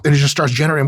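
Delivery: ordinary talk, nothing marked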